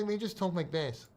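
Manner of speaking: soft put-on troll voice; mocked commenter talk. Imitating how idiots in the comments talk, mocking. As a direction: mimic the commenters under your voice. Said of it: "That's how they talk".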